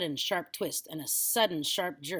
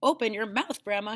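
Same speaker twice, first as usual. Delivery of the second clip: louder, higher urgent call; boy calling through the smoke; cried; 5 semitones higher, 6 dB louder than usual. A narrator's reading. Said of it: "he cried".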